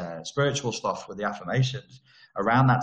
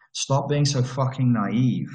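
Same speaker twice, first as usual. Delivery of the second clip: usual speaking voice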